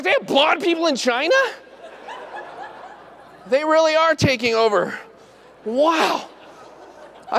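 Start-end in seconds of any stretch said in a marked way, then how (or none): 0.0-6.3 high, laughing astonishment; amazed disbelief. Retold or imitated. Dentist marveling at the patient's hair source.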